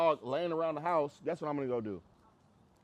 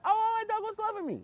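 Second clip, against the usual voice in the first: high, gushing mock owner voice, much higher and louder; naive dog owner; 5 dB louder than usual. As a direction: squeal it like a doting owner. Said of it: "Some may view that as".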